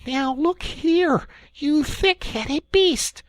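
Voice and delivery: very high, slow scolding; angry. Acted. Angry Rat scolding Mole slowly. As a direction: bite off each word. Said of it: "really angry".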